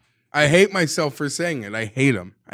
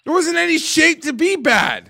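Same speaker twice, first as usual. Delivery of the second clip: much higher, louder emphatic outburst; loud exclamation; 13 semitones higher, 6 dB louder than usual. Real, not acted. Fan describing his state after the loss, loud exclamation. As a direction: blurt it out big.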